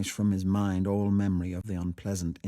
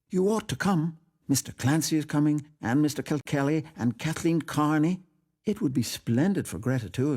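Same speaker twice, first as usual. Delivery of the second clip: put-on eager young woman; 7 semitones higher, 3 dB louder than usual. Miss Ivors voiced, eager invitation.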